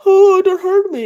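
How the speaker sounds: high falsetto coy plea; coy damsel. Retold or imitated. Little Miss Muffet coyly begging the spider, high falsetto. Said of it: "she's all going".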